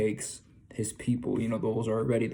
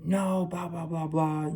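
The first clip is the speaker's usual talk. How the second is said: mock yelling; 7 semitones higher, slower than usual. Guy in the dream scolding the dreamer, mock yelling. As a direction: imitate his yelling with drawn-out, higher blahs. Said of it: "he just kept yelling at me".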